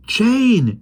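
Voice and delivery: high call of her name; crying out. Acted. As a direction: call out to Jane. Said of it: "he cried".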